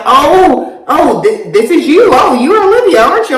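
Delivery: a hint of a dad sizing up the new girlfriend; surprised dad. Dad meets the girlfriend, retold.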